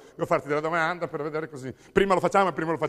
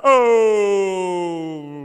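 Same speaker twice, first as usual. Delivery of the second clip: long, loud "oh!"; drawn-out shout; 2 semitones higher, 7 dB louder than usual. Acted. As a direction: holler it out and hold it. Comic protest, calling out.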